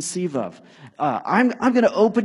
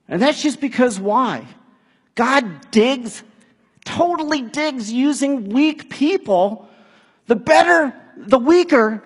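loud, higher, driving pulpit voice; rising sermon fervor, louder and higher; full-force preaching; 7 semitones higher, 7 dB louder than usual. Acted. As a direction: preach it out to the back row.

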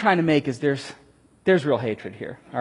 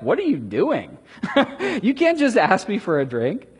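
blunt question broken by a laugh; laughing disbelief; 4 semitones higher than usual. Retold or imitated. Samaritan woman brushing off Jesus's request, laughing. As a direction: laugh him off, straight up. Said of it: "she's just straight up like".

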